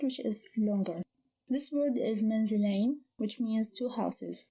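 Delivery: ordinary talk, nothing marked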